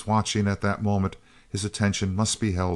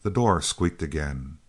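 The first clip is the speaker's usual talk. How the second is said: ordinary delivery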